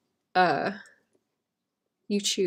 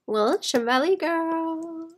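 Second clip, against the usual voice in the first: high, slow mock Welsh valley girl voice; playful put-on Welsh valley girl accent; 9 semitones higher, much slower than usual. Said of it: "I should learn to do a better Welsh accent".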